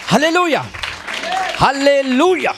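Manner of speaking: preacher's high call to the crowd; rallying shout. Real, not acted. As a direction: rouse the congregation to answer. Preacher calls congregation for response.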